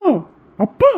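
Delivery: high cry of pain on the word. Acted. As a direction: yelp from the cut, calling his father. Son cries out in pain.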